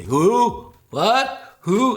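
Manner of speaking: high, loud dopey questions; confused stoner. Retold or imitated. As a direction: play dumb behind the door. Acting confused through door.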